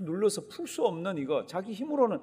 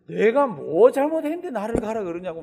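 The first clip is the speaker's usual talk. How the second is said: higher, louder indignant outburst; loud protest; 6 semitones higher, 9 dB louder than usual. Retold or imitated. Preacher voicing David protesting to Achish, loud and indignant. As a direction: demand it like a wronged man raising his voice. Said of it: "다윗이 뭐라 그러죠?".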